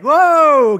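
loud, high, drawn-out whoa; alarmed shout. Retold or imitated. Team sees coach hit in the mouth, alarmed shout. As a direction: imitate players calling a halt.

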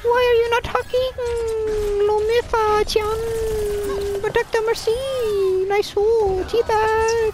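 Mercy voice. Pretend. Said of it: "This is the Mercy voice!".

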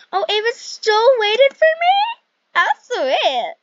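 high-pitched, delighted gushing; sweetly thrilled, pitch shooting up; touched delight. Real, not acted. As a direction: gush loudly over a friend's kindness. Touched that friend waited in game.

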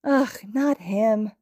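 slow, annoyed groan. A narrator's reading. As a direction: groan it in disgust. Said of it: "Hagakure groans".

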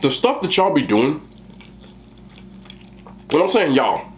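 chewing between words; muffled talking while eating; mouth full of food. Real, not acted. Seen 2: takes a spoonful at -2; closed, working jaw, food on lip.

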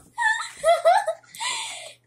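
loud, very high squealing laugh. Real, not acted. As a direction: burst into a high-pitched laugh. Laughing during the game.

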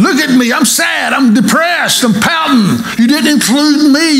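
a hammed-up pouter begging for attention; public pouter. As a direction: wail it like a sulking kid hamming it up. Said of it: "I want people to see I'm pouting".

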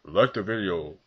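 mock demanding youtuber, loud on now; pushy youtuber. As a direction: parody a needy creator begging for likes. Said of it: "I'm not one of those people who's like".

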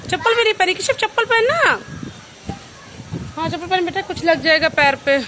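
loud high scolding call. Real, not acted. Mother telling Keshav from afar to wear slippers, loud scolding call. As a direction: call out the warning across the yard.

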